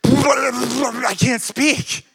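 hammed-up sputtering babble, loud and high; flabbergasted. Amazed teachers lost for words before the boy, flabbergasted. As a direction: splutter as if struck speechless. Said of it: "for amazed there is flabbergasted".

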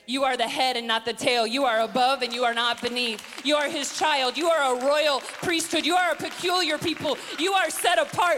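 loud, high, rhythmic declarations building in intensity; rousing sermon crescendo; full-force preaching. Real, not acted. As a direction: proclaim identity over the congregation, climbing.

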